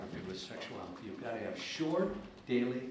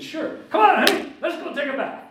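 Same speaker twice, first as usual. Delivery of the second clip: high, bright eager daddy voice; cheerful dad; 12 semitones higher, 11 dB louder than usual. Retold or imitated. Dad cheerfully agreeing to bathe his daughter, voice high. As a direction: sing it out like a happy father.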